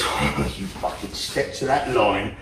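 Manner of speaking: low, hushed menacing threat; a quiet growl of warning, not a yell; understated indignant menace; mock righteous indignation. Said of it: "righteous indignation that says".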